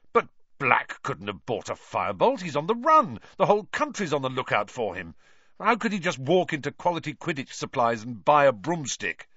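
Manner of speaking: a captain who can't believe it; agitated disbelief. A narrator's reading. Wood protests, agitated captain.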